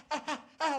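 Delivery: high, startled "ah ah ah" noises; flustered yelps. Retold or imitated. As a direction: yelp in mock panic. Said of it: "like that".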